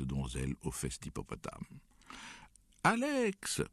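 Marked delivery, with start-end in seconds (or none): 2.8-3.6 high, loud surprised cry; joyful exclamation. A narrator's reading. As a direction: hail the newcomers with delight. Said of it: "nous exclamâmes-nous".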